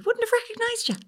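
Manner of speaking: high, squealing mock fan voice; gushing fan. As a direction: ham up the tactless fan. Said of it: "retelling the fan".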